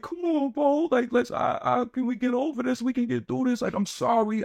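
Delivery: high whiny fake-sobbing plea, slowing at the end; mock crying. Imitating the friend begging to make up, high mock crying. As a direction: mimic a phony apology through fake tears. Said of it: "this how you crying".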